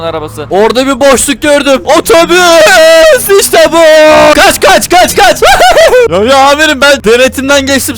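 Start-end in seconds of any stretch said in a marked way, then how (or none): 2.0-3.8 high, loud, stretched cry; excited shout. Real, not acted. Gamer spotting a bus gap, shouting excitedly. 4.3-5.4 urgent run cry. Real, not acted. Gamer fleeing the toll booth, shouting excitedly. 5.5-6.1 very high burst of laughter; triumphant laugh. Real, not acted. Gamer laughing after escaping the toll booth.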